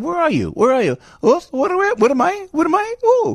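high, incredulous questions; a hint of accent-tinged confusion, pitched high; mock bewildered. Host replaying his own baffled questions to the caller, mock bewildered. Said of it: "So I said".

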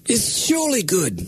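lightly hammed-up British gentleman; mock British accent. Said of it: "you read it in his accent".

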